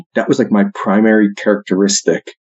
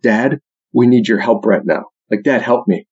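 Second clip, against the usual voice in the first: ordinary talk, nothing marked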